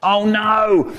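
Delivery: high, drawn-out oh no; dismayed cry. A narrator's reading. Dave hears about Haribo's granddad, dismayed cry. Dave reacts to bad news.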